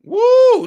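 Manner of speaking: high, loud "woo"; mock-impressed whoop. Sarcastic reaction to supposedly violent crowd.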